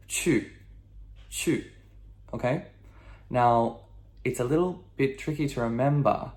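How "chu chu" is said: each "chu" falling steeply; sharp descending tone. Shown as a demonstration. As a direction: say "chu" as a firm downward drop. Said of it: "sharp, okay?".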